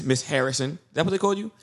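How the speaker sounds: ordinary talk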